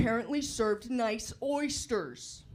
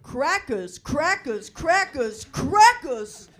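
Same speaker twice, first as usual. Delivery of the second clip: crackers, crackers, crackers, crackers building from firm to a yelled crackers; building shout; 4 semitones higher, 10 dB louder, slower than usual. Acted. Comic listing foods, building to a shout on crackers. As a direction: ramp each crackers louder until shouting. Escalating repeated word for laughs.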